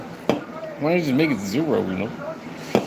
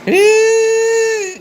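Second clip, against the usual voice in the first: crying shout; 6 dB louder than usual. Real, not acted. Vowing he'll always be here.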